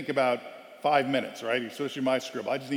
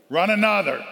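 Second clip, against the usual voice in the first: louder barked order; coach bark; 6 semitones higher, 9 dB louder than usual. Retold or imitated. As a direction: snap back the punishment. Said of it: "first thing out of coach's mouth".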